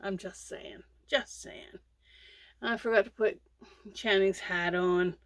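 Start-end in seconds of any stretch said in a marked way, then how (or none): 1.1-1.8 breathy whisper of the repeat; whispered aside. Real, not acted. Vlogger whispering advice to newbie crocheters. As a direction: drop it to a whisper, teasing.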